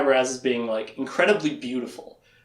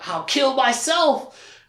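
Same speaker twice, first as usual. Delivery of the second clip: high, slow, melodramatic cry; hammed-up imitation of despair; mock distraught wail; 9 semitones higher, much slower than usual. Distraught woman fears hurting Richard, mock wail.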